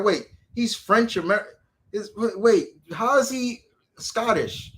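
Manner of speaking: high-pitched imitated bafflement; mock puzzled. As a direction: act out a confused viewer working it out. Voices puzzled first-time movie viewers.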